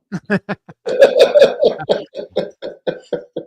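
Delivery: breathy burst of laughter; real laugh; airy laugh. Real, not acted. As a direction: laugh at the memory. Laughing at the punchline.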